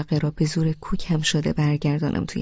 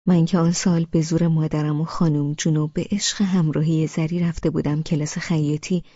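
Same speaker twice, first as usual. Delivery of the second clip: plain reading voice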